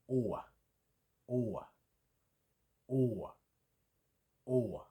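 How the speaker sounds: O ending in a short flick toward E. Shown as a demonstration. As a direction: say O, then a quick flick of E at the end. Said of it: "It's got a kind of flick on the end".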